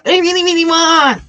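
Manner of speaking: high, whiny voice, begging; mock whiny wanting. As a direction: play someone pleading for the job. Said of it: "I'm not making fun of you".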